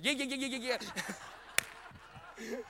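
rapid repeated little yips; mock woman-preacher yapping. Preacher joking about a woman preacher, mock yip-yapping. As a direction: yap like a tiny dog, teasing. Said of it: "Like a woman preacher".

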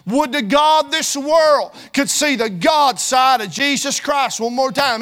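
slow shouted plea, stretched out; preaching shout. Acted. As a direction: cry it out to the whole church. Climax of the plea.

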